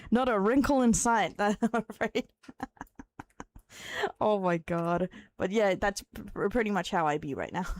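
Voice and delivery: ordinary talk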